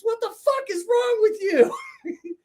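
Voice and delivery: very high, drawn-out mock-furious wail; hammed-up exasperated outrage, slowly; mad friend. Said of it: "he was mad".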